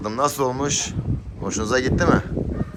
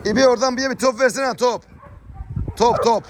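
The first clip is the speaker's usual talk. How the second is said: calling out; 12 semitones higher than usual. Real, not acted. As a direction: call across the yard, pitched high. Calling for a ball.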